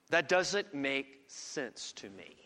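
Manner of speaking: slow, hushed honest admission; a preacher confessing his doubt, slow and quiet; quiet lament. Real, not acted. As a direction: let it sink, slow and soft. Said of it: "that's honest talk".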